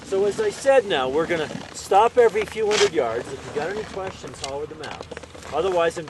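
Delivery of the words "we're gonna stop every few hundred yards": usual speaking voice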